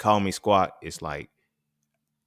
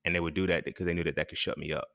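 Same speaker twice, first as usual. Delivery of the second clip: usual speaking voice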